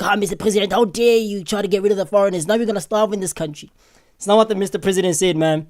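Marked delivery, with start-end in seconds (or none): 0.0-3.7 softer, hammed-up panicked plea; mock panic. Vlogger parodying a panicking protester, mild mock panic. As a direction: parody her panic attack, half-hearted. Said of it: "She's having a whole frenzy, a panic attack".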